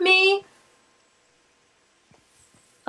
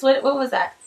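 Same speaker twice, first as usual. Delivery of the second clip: normal talking voice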